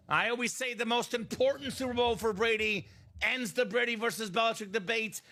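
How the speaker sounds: ordinary delivery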